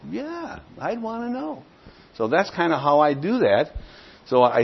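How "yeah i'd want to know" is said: much higher, softer imitation of a keen listener; high, soft mock reply of a willing listener; mock eager prospect. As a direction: play the convinced visitor in a bright high voice.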